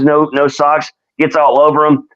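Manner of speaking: normal talking voice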